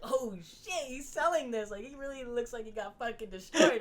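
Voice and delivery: awed fan reaction; impressed fan. Retold or imitated. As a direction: gasp in awe at the selling. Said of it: "you're just like".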